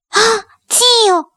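high, loud cry to her uncle; exclaiming headmistress. A narrator's reading. Headmistress hearing her dead uncle, crying out high. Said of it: "esclamò la signora Geltrude".

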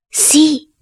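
high, loud confirmation from the cook; medium's pronouncement. Acted. Cuoco riferisce il responso. (responso solenne, alto e forte)

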